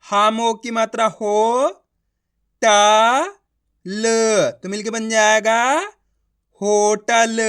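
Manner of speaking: teacher calling out "हो" "टा" "ल" and joining them; syllable by syllable. Shown as a demonstration. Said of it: "आ रहा है समझ में?".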